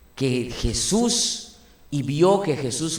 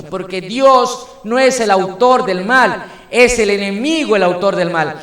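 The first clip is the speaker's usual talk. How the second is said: loud, raised sermon voice pressing each point; full-force preaching; 7 semitones higher, 8 dB louder than usual. Acted. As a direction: preach it out to the congregation at full power.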